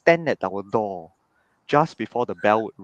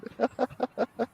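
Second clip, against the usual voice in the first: high giggle; 14 semitones higher, 4 dB quieter than usual. Real, not acted. Laughing at memory.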